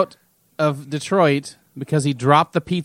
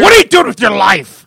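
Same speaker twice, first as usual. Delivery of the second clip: much louder, higher scolding; mock exasperation; 5 semitones higher, 12 dB louder than usual. Cohost teasing partner for sleeping late, loud mock scolding. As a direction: scold him in mock disbelief.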